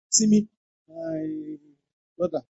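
normal talking voice

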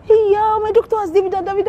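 Nigerian Pidgin news, high and fast; excited. Retold or imitated. Friend breaking the news in Pidgin, high and excited.

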